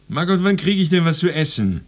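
loud, high, impatient call; impatient yell. Acted. Hungry husband calling his wife, impatient yell. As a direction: holler to the next room for food.

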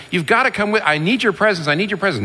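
high-pitched, insistent mock pleading; arguing plea. Moses begging God to come along, arguing. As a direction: act out an urgent plea, pitch jumping up. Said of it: "gets into an argument with God".

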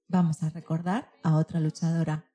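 usual speaking voice

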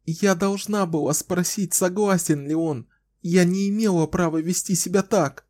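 put-on high, tearful young woman; sobbing. A narrator's reading. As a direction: cry through the confession. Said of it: "всхлипывала она".

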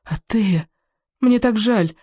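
high, tearful lament; choked with tears. Acted. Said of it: "задыхаясь от слёз". (высоко, сквозь слёзы)

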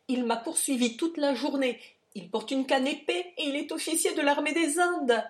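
high, loud, agitated account; frightened, rising urgency; alarmed. A narrator's reading. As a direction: build to a panicked pitch. Frightened fugitive describes his pursuer.

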